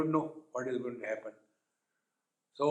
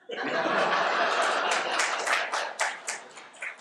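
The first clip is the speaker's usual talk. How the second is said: hearty laugh. Real, not acted. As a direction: let the laugh roll out. Speaker laughs at own joke.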